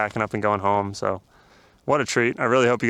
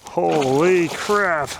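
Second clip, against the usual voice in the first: thrilled awe; 7 semitones higher, much slower than usual. Real, not acted. Landing a huge pike.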